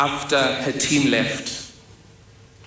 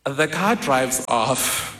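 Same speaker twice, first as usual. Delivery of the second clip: normal talking voice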